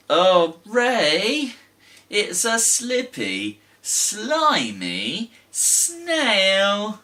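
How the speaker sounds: high and drawn out, very slow on slimy snail; delighted. Pretend. Teacher marvelling at the snail from the hat. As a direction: savour the sliminess.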